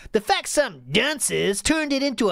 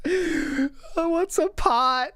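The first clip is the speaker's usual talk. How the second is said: higher, slow bewildered 'pot'; mock bewildered; 8 semitones higher, much slower than usual. Mocking the streamer's confusion, higher slow puzzled voice. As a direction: stretch 'what's a pot' like it's alien.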